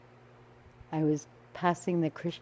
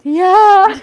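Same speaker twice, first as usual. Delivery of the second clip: almost crying; 13 semitones higher, 17 dB louder than usual. Retold or imitated. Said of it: "I was almost crying".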